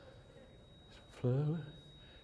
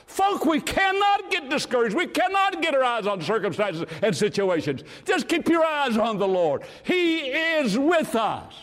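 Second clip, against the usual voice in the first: preaching at full force; 10 semitones higher, 4 dB louder than usual. Acted. Preacher exhorting the congregation.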